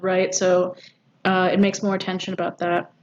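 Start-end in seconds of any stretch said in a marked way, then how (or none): none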